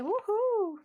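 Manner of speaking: high, drawn-out whoop; excited cheer. Real, not acted. Crafter tells customer the order is posted, excited cheer. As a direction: celebrate with a high-pitched cheer.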